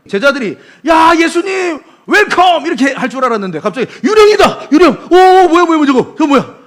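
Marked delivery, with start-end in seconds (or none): 0.9-2.7 high, loud happy greeting; cheerful welcome. Pretend. Disciples happily welcoming Jesus on the water. 4.0-6.6 high, loud hammed-up screaming; mock panic. Disciples shrieking in mock terror at the figure.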